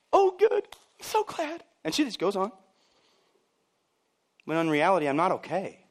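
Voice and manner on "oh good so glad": high, put-on delight; gushing churchgoer. Churchgoer hearing the pastor is fine, gushing. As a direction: trill it with over-sweet relief.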